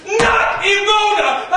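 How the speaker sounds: NOT hammered in a slow shout; angry shout. Acted.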